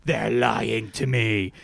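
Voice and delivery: drawn-out impression of a suspicious contestant; diary-room voiceover. Reality contestant confiding in the diary room, mock ominous. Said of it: "her voiceover from the diary room is like".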